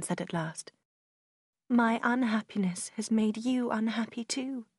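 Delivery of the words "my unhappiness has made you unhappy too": plain reading voice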